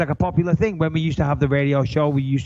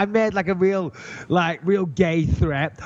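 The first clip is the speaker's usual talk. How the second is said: ordinary delivery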